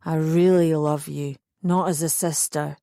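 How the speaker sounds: put-on low drunk voice; low, earnest drunken declaration; drunken earnestness. A narrator's reading. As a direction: press it home in a low boozy voice. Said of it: "he was plastered".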